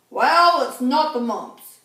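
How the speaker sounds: loud, pompous doctor's pronouncement; booming doctor. A narrator's reading. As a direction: declare it like a self-important doctor. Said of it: "concluded Doctor Grop".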